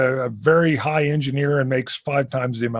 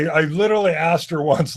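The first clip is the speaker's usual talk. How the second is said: normal talking voice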